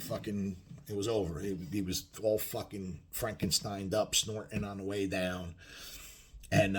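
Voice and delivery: ordinary talk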